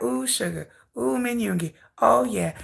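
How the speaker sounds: soft, dreamy swooning coo; swooning fan. Retold or imitated. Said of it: "I was all like".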